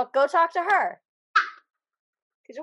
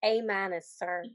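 lower, softer Southern drawl; 4 semitones lower, 6 dB quieter than usual. Retold or imitated. Podcaster repeating the trainee's answer to show off the Southern drawl. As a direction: try out her drawl, low and soft. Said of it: "the only thing we can say with the drawl".

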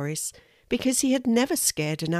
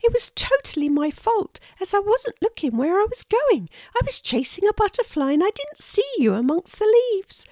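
very high, louder small-creature voice; apologetic little squirrel; 5 dB louder than usual. Acted.

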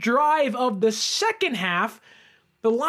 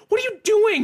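high, louder furious shout; angry shout; 9 semitones higher, 4 dB louder, faster than usual. Retold or imitated. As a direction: bark it at him in disbelief. Ranting at laughing Reynolds.

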